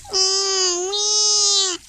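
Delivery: put-on loud whiny cry of a scared little girl; scared whimper. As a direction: whimper in fear of the water. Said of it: "She's scared of the water".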